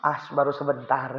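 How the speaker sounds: quiet, soft, low-key reply; hushed, modest understatement; soft downplaying. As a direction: shrug it off gently. Imam softly downplaying.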